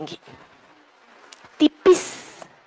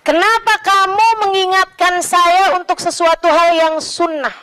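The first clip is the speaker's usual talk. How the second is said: ordinary delivery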